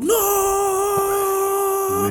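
high mock "No!" yell; imitated yell. Retelling the lady who yelled at the collector, high mock yell. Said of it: "She just yelled".

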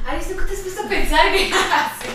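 un poco más alto y fuerte, entre risas; imítala divertida y filosófica; imitando a Kesley ligeramente. Retold or imitated. (imitación ligera de amiga pensativa)

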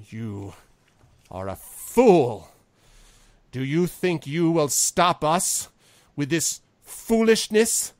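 slow, loud, high-pitched contempt; furious Envon. Acted. Envon turning on the lord who refused him, furious. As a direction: rage at them, leaning on each word. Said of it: "Now he's just pissed".

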